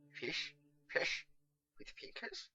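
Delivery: aghast at the idea; startled wizard horrified by fish fingers. A narrator's reading. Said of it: "with horror".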